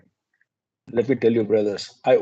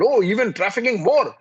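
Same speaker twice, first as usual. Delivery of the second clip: high, mocking jab; sarcastic captain; 7 semitones higher than usual. Captain scoffing at the suspect, sarcastic. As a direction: sneer it like a sceptical officer. Captain mocks the extra bag.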